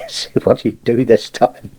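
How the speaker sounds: plain reading voice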